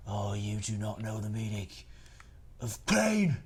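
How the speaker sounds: angry rough Jon Snow. Acted. Streamer plays Jon Snow, angry and rough. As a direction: growl it, then roar pain. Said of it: "He's sounding angry and a bit rough".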